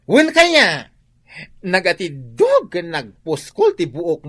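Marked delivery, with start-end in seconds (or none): 0.0-0.9 high, loud "Ay!" of surprise; delighted surprise. Acted. Parlor owner shrieking with surprise at her friend's new hair.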